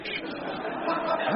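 unvoiced breathy laugh; breathless laugh. Real, not acted. Stunned at the lid, an airy disbelieving laugh.

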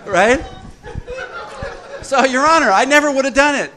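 high, loud and laughing; mock the excuse while laughing. Real, not acted. Mimicking sponsee's excuse to the judge, laughing.

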